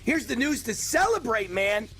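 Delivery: high-pitched, gleeful announcement; celebrating. Real, not acted. Host sharing the best news of the day, celebrating. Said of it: "I was, like, celebrating".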